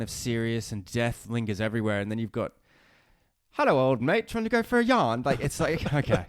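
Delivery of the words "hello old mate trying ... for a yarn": high, bright mock-friendly chatter, climbing up; mock chummy pawn. Mocking the pawns' chatty small talk, high and hammed up. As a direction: ham up a chummy Aussie companion.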